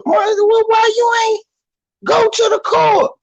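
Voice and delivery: very high falsetto exasperation; exasperated challenge. Acted. Urging a complaining father toward court, exasperated. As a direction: squeal the question in disbelief.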